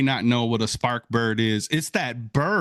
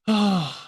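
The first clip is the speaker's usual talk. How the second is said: breath out in relief; relieved sigh; 7 semitones higher than usual. Retold or imitated. Viewer seeing the bird surface, relieved sigh. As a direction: let out a big relieved "oh". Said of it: "so much relief".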